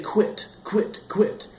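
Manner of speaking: "quit" breathed out unvoiced and high; whispered quit. Shown as a demonstration. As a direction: push "quit" on air with no voice. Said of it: "I just say".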